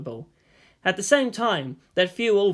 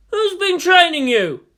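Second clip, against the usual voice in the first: loud, much higher indignant shout; aggrieved shout; 13 semitones higher, 8 dB louder, slower than usual. Acted. Yang aggrieved that Shinji outbargained him, shouting. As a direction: shout it in mock outrage. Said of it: "Yang shouted, aggrieved".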